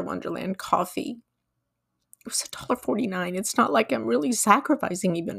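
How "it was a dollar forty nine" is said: fast, whispered throwaway; quick whispered aside. Acted. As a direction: toss it off under your breath. Self-deprecating aside.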